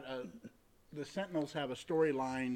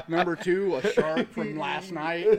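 laughing; 6 semitones higher, 11 dB louder, slower than usual. Real, not acted. Laughing through a joke list.

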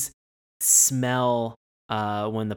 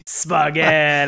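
spooky echo; 3 semitones higher, 8 dB louder, slower than usual. Acted. Echoing spooky punchline.

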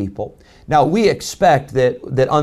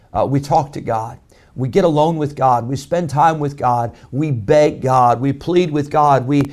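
ordinary talk

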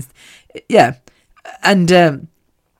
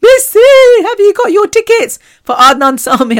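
high squealing fan voice, much louder; squealing excited fans; 5 dB louder than usual. Retold or imitated. Friends pester Missy about concert tickets, squealing high. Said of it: "contacted me and saying".

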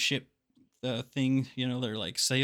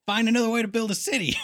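much higher, louder and fast; exasperated shout; 9 semitones higher, 6 dB louder, faster than usual. Acted. Ranting at Numenor's builders, laughing exasperated shout. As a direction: blurt it out in mock outrage.

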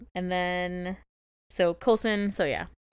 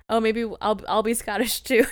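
higher, louder, badly faked Scottish accent; clumsy mock-Scottish, pitched up; 4 semitones higher, 6 dB louder than usual. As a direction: imitate someone failing at a Scottish accent. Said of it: "she's probably as bad as me".

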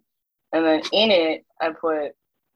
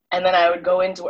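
ordinary talk, nothing marked

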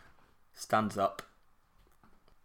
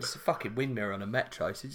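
ordinary talk, nothing marked

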